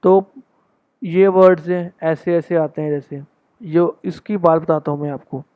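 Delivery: ordinary delivery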